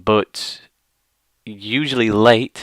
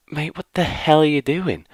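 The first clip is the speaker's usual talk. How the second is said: high-pitched mock disbelief, not shouted; acted indignation; 7 semitones higher than usual. Onlooker challenging the big guy, acted indignation. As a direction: protest in appalled disbelief.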